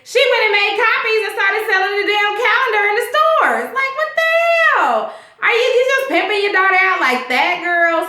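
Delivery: shocked and appalled, calling it out; outraged disbelief. Real, not acted. Outraged at Kris Jenner's calendar.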